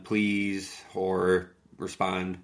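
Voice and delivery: ordinary delivery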